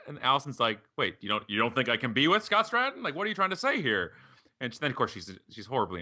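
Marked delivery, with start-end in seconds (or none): 1.0-4.1 higher, indignant retold protest; mock offence. Guest acting out his co-author challenging the woman, mock offended. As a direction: climb in pitch as if affronted.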